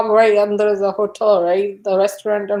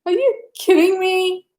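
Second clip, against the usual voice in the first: high, disbelieving squeal; incredulous; 9 semitones higher than usual. Retold or imitated. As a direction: act stunned that she won't believe you. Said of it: "I was like".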